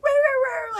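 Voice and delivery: high-pitched mock dog wail; imitated dog crying. Imitating her dog crying in booties, high mock wail. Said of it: "he's, like, crying".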